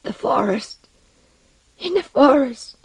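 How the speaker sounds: high, distraught outcry building in force; high-pitched, anguished patient; crying out in distress. A narrator's reading. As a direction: cry out as if terror is returning. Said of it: "he cried out, his eyes growing bright and his features contorting".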